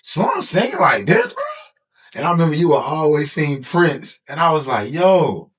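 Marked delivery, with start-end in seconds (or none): 0.0-1.4 amazed. Retold or imitated. Amazed reaction retold. 4.9-5.5 high disbelieving exclaim; amazed. Retold or imitated. Amazed reaction retold.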